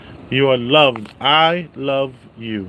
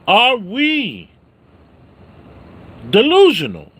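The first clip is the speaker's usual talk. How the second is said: mock outrage; 9 semitones higher, 6 dB louder than usual. Real, not acted. Indignant rhetorical question.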